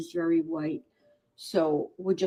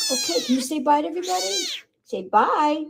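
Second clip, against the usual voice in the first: much higher, fast sing-song coaxing; 10 semitones higher, faster than usual. Real, not acted. Rescuer asks the kitten to say bye, high quick baby talk. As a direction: coax the kitten to wave bye.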